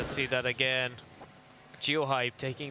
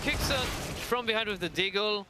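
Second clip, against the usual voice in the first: excited caster call; 7 semitones higher than usual. Real, not acted. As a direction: jump up as the play happens. Sudden play called live.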